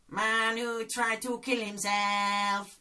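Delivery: slow, high put-on doctor voice; Doctor Bob impression. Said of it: "Lee Nelson's character, Doctor Bob".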